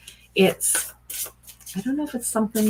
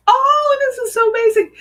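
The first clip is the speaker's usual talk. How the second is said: much higher, louder amazed cry; thrilled; 9 dB louder than usual. Real, not acted. Reader seeing the baby card, loud high thrilled cry. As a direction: burst out in delight. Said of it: "I got tears".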